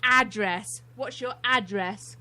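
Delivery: "address" with a full, stressed first syllable; front-stressed "address"; American "address". Shown as a demonstration. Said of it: "that's how Americans say it".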